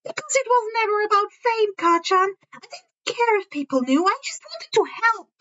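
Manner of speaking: put-on high, sincere young boy's voice; Izuku's voice.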